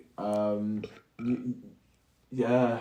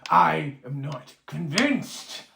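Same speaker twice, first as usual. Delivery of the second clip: high, loud repeated demand; dwarf yelling at Yarner before the crowd, high and loud; 10 semitones higher, 13 dB louder, much slower than usual. Acted. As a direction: hurl each how at him. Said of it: "the dwarf yells out in reply".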